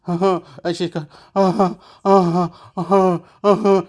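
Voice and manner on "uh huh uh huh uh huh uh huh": imitated sobbing in short repeated bursts; hammed-up panicky crying noises; mock freak-out sobs. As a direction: sob like a mother freaking out. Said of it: "stop freaking out".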